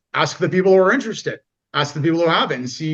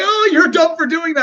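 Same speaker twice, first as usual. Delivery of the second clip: much higher, louder mock scorn; teasing friends; 13 semitones higher, 5 dB louder than usual. Friends ribbing the jock, much higher and louder. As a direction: tease him affectionately. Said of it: "And we're all like".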